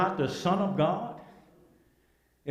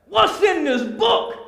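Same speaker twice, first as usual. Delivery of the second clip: much higher and louder pleading question; excited preaching cry; 8 dB louder, slower than usual. Acted. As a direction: cry out eager to know. Asks about the book.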